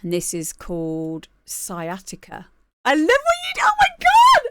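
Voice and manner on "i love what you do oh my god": high, loud delighted shouting; excited shout. Real, not acted. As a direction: shout it out with joy. Reacting to track name.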